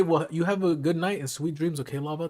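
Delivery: normal talking voice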